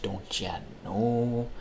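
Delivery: put-on a touch of a slow Midwest accent. Tacking on the Midwest catchphrase, slow mild accent. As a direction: drawl "don't ya know" slowly. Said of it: "the Midwest accent".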